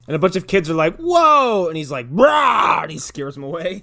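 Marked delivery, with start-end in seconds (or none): 1.0-1.7 high, loud startled cry; kids' startled whoa. Retold or imitated. Kids react to duck. 2.1-2.8 loud, high mock roar; scary roar. Howard scares kids.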